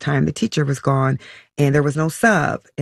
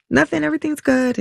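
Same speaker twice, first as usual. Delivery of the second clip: high, quick mock-casual reassurance; feigning normal; 13 semitones higher, faster than usual. Mother on the phone hiding upset from daughter, feigning normal. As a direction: brush it off too quickly, pitched way up. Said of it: "trying to make my voice sound normal".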